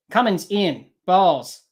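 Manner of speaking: normal talking voice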